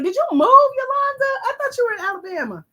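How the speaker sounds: high, loud squeal of surprise; sudden disbelief to a friend; surprised. Real, not acted. Surprised at viewer Yolanda's location.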